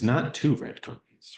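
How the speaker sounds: "two" accented as the wrong number; emphatic "two", tune rising at the end; contrastive stress on two. Shown as a demonstration. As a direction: rule it out by leaning on "two". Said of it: "you're conveying something that's more than just the words".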